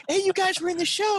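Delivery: high-pitched squeal of imitated fans; excited female fans. Girls greeting the actors at stage door, mock excited. Acting out the girls after show.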